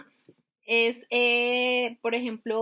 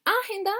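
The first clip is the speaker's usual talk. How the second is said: agenda with the first syllable A punched higher; misplaced stress, AH-henda for agenda; 9 semitones higher than usual. Shown as a demonstration. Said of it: "¿dónde lleva el acento?".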